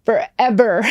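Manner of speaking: retold overwhelmed outburst, rising and slowing; losing it. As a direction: draw the words out as emotion climbs. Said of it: "Oh my God, I lost it".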